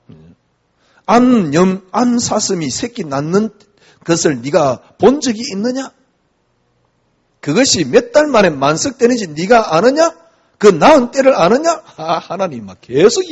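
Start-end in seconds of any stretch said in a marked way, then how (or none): 1.0-11.8 rising, loud proclamation of God's questions; preaching scripture at full force. Acted. Preacher booming God's challenge to Job.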